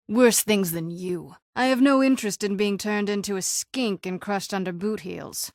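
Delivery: normal talking voice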